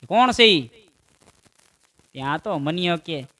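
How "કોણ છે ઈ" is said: loud angry demand; angry mother. Acted. Angry mother demanding answer from son, loud. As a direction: snap who is it.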